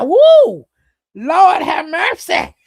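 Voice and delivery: loud, high-pitched whoop of mock shock; retold scandalized outburst; shocked exclamation. Acting out shocked reaction to slip.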